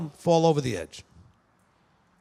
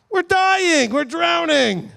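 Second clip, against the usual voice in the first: high, loud cry of alarm; hammed-up, high-pitched desperate wail; mock panic; 10 semitones higher, 4 dB louder, much slower than usual. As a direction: act out sailors panicking in a storm. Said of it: "it wouldn't be panicking".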